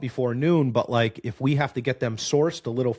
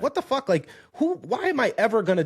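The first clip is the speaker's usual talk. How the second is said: high, disbelieving what the fuck; incredulous disbelief; 10 semitones higher than usual. Retold or imitated. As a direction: squeak it out in shocked disbelief. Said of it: "You're like".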